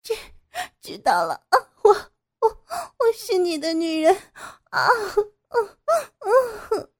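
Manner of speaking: high, sobbing broken reply; frantic answer. Acted. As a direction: answer wildly between cries. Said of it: "傅菊英狂乱地回答". (狂乱哭着回答)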